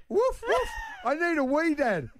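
put-on very high squeaky woof then a small child's plea; little boy as dog. Son Otis answering dad mid-wee, very high squeaky child-dog voice. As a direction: yip like a puppy, then whine like a toddler. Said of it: "And he goes".

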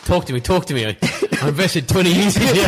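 high, comic imitation of pleading; pleading with his legs. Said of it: "Talking to his legs".

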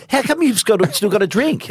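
high-pitched imitated challenge; high, retold protest; mock indignant. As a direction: pipe up like the puzzled crowd. Everyone's challenge to him, acted out.

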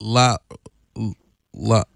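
halting, one word at a time; slow word-by-word French; staccato. Shown as a demonstration. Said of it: "One word at a time".

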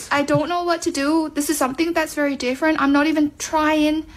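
ordinary delivery

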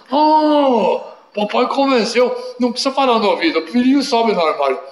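put-on high, quick little boy conceding; childish giving in. Child gives in.

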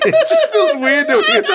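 high-pitched words through giggles; real self-conscious laughter; giggling embarrassment. Real, not acted. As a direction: laugh at your own bad impression. Giggling at own impression.

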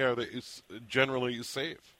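ordinary talk

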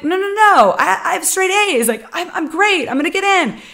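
high-pitched, insistent pushback; indignant protest. Retold or imitated. Student protesting to the counselor, high indignant no. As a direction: refuse to hear it, voice jumps up.

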